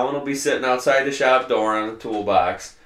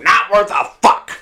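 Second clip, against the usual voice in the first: mock holler, pitched up; imitated gruff shout; 6 semitones higher, 4 dB louder than usual. Old farmer answering how he is, mock gruff holler. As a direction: blurt it out as a cranky old farmer.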